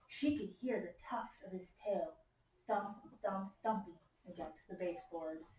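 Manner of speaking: soft, whispered suspense; spooky whisper. A narrator's reading. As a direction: hush the class with the scary part. Spooky moment in the story.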